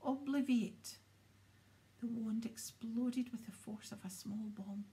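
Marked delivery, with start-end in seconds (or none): 0.0-1.0 loud spell yell. A narrator's reading. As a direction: shout the spell out. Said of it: "and yelled".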